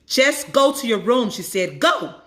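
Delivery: a furious mom ordering him away; angry mom. Acted. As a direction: send him off in anger. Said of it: "jaw clenched and face red".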